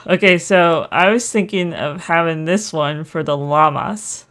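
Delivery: ordinary delivery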